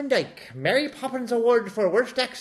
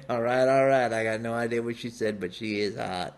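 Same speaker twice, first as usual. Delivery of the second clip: Matthew McConaughey impression; 10 semitones lower, 4 dB quieter, faster than usual.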